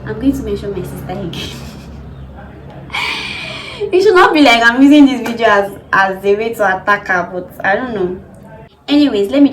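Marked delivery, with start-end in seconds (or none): 1.6-3.7 laugh. Real, not acted. Laugh mentioning sister again.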